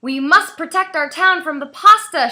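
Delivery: rally the town; shouting. A narrator's reading. Mayor shouting orders to the townspeople. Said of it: "shouted the mayor".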